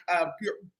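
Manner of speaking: ordinary talk, nothing marked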